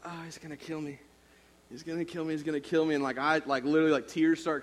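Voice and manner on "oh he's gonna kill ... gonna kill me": starts much softer, then back up to full voice; frightened mutter that grows; panicked dread. Retold or imitated. Scared boy seeing his dad watching, dread that builds. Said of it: "And I'm like".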